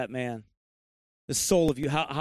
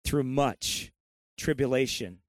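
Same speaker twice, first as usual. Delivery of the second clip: usual speaking voice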